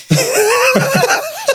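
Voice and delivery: squealing high laugh; bursting into high laughter. Real, not acted. Laughed at for [name] story.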